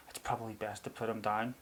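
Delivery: imitated low, very soft sympathy; sad vet. Vet advising on a sick budgie, sad and quiet. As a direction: gently deliver bad news as the vet. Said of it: "he was being really, like, sad about it".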